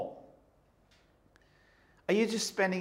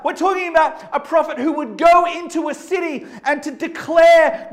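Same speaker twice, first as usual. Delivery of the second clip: higher, louder, shouting sermon voice; preaching at full force; 9 semitones higher, 9 dB louder than usual. Acted. Preacher to the congregation about Jonah, in a full-force shout. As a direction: drive it home from the pulpit.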